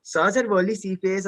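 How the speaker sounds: normal talking voice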